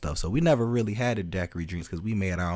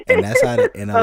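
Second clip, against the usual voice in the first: excited; 9 dB louder than usual. Real, not acted. Excited outburst.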